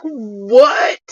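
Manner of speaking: mock disbelief, voicing an affronted deer; offended deer. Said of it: "you offended the hell out of that deer".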